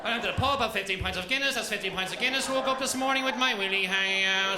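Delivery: pub story in receptionist sing-song; receptionist voice off duty. Retold or imitated. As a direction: tell it like a reception announcement. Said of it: "You don't talk like that outside of work".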